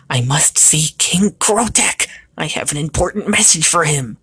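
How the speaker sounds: high, urgent little goblin voice; high-pitched goblin messenger; small warty goblin. Acted. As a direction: pipe up as a small creature with an important message.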